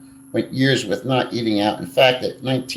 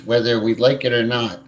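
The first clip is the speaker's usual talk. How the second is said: ordinary delivery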